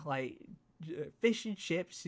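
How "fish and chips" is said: mock Cockney 'Oi, governor'. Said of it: "the stereotypical".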